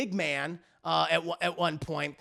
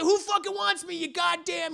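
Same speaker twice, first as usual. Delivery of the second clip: high-pitched mock-furious taunt; acted riled-up, high outburst; combative challenge; 11 semitones higher than usual. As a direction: dare the whole room to fight.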